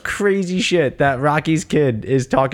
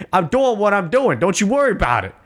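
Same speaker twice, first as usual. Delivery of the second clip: higher-pitched mock defiant teenager; heavy talk back; 6 semitones higher than usual. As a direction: imitate a daughter sassing her parent. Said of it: "gives you some heavy ass talk back".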